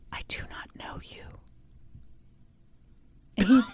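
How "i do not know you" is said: hushed, breathy whisper of denial. Retold or imitated. Host imitating Amy denying she knows Bill, in a whisper. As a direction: whisper it flat and scared. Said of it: "Here's how she did it".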